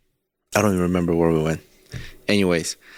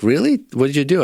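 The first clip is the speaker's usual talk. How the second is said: wife's surprise; 6 semitones higher, 3 dB louder, faster than usual. Retold or imitated. Wife voiced, surprised at news.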